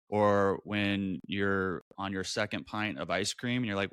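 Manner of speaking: usual speaking voice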